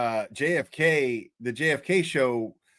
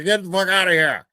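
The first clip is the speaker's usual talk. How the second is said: mock grumpy neighbour, higher and a bit louder; grumpy neighbour yelling; 6 semitones higher, 3 dB louder than usual. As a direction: imitate the annoyed homeowner shooing cars away. Voicing the angry neighbour.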